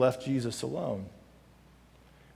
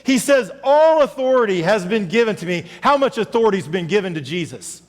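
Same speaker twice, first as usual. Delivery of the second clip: louder, higher preaching to the congregation; full-force preaching; 11 semitones higher, 11 dB louder than usual. Acted. Pastor rallying congregation to serve, preaching hard. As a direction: pastor urging the church to go serve.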